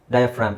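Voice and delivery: ಡಯಾಫ್ರಾಮ್ with fra running into m. Shown as a demonstration. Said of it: "ಇಲ್ಲೆಲ್ಲೂ ಜಿ ಇಲ್ಲ".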